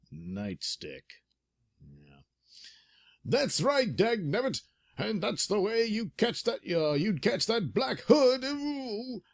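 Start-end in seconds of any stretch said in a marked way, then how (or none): 3.2-9.2 put-on high old-timer, dagnabbit; old coot voice. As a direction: cackle it like a grumpy old cop. McGinty, dagnabbit.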